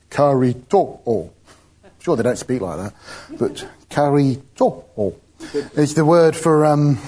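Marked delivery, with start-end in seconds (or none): 0.1-1.3 slow, drawn-out serious computer voice; really serious voice. Retold or imitated. Preacher mimics the Hebrew pronunciation app, really serious voice. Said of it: "a really serious voice goes". 3.9-5.1 slow, mock-solemn computer pronunciation; really serious voice. Preacher repeats the app's Hebrew word, really serious voice. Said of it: "a really serious voice goes".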